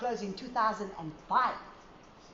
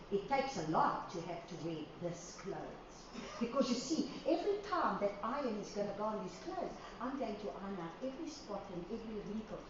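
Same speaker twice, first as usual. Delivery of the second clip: gentle divine voice; 3 semitones lower, 11 dB quieter than usual. Acted. Voicing the Father, soft and low.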